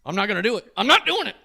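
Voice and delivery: loud, high yelled refusal, imitated; adamant refusal. Tattooer refuses to go on.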